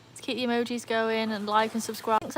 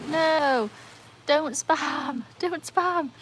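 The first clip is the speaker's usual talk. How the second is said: higher, playful exclamations; mock protest; 6 semitones higher, much slower than usual. Streamer begs chat not to spam, mock protest. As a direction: wail a pretend plea at the chat.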